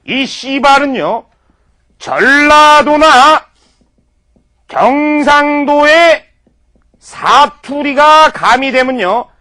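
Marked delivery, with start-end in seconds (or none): 2.0-9.3 slow dramatic buildup. Acted. Comedic lecture build-up.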